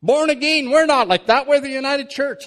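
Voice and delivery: high, loud, huffy objection; mock offended church lady; a touch of a scandalized congregant; indignant churchwoman. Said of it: "Starts harassing Donna Mann".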